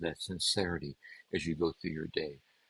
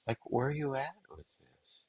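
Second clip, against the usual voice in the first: higher, softer pressing question; 6 semitones higher, 4 dB quieter than usual. Acted. God pressing the listener again, higher and softer. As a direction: lift it up high and ease off the volume.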